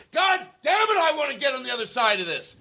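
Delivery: high shouted frustration; yelling. Retold or imitated. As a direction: yell it out, way up in pitch. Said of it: "yell it a hundred times more".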